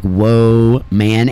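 woman pulled apart into "Whoa, man"; mock woman as WHOA, then MAN; two separate words, Whoa and man, for woman; mimicked mispronunciation. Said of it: "And they're like".

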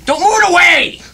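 high, sharp shouted order; exasperated yell. Real, not acted. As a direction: shout it up high in alarmed annoyance. Yells at helper holding mic.

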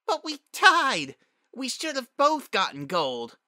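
put-on loud, high childish whine; whining. A narrator's reading. Young Spitfire insisting on gold, whining. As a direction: protest in a drawn-out whine. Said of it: "Spitfire whined".

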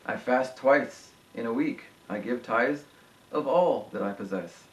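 subdued, deliberate reading from the pulpit; solemn scripture reading. Acted. Reads scripture aloud.

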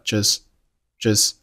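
"just" ending on the s; dropped t in "just". Shown as a demonstration. Teacher demonstrating "just" with the t unreleased. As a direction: say "just" without its final t. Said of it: "just结尾的t也没有爆出来".

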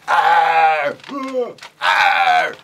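loud puppet roar; angry dinosaur roar. Acted. Puppet dinosaur roaring at creatures, loud angry roar. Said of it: "a very angry dinosaur".

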